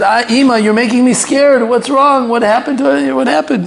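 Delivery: high, frightened questions; scared daughter. Retold or imitated. Daughter asking her frightened mother what happened, very high. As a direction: ask in alarm what's wrong.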